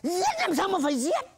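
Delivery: high, loud frightened protest; scared, indignant retort. Acted. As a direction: snap back in a panic. Thầy sợ, cãi lại. (cãi to, cao giọng hoảng hốt)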